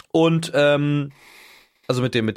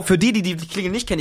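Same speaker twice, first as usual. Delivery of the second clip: normal talking voice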